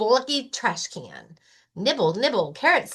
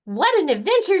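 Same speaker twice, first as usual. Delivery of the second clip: high, loud thrilled bunny cry; Rabbit shouting; 10 semitones higher, 5 dB louder, faster than usual. Acted. Rabbit cheering about a night in the library, high and loud. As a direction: burst out with delight, pitched high. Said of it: "shouts Rabbit".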